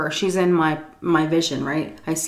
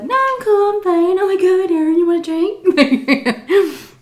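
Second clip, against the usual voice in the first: put-on high-pitched, chummy drunk; mock drunken cheeriness; switched drunken mood; 12 semitones higher, 4 dB louder than usual. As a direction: act suddenly all fine and friendly, voice way up. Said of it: "your mood switches like that when you're drunk".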